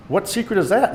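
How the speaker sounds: coy. A narrator's reading. Date asking the boy about his secret, coyly. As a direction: lift it up playfully, fishing for the secret. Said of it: "my date asked coyly".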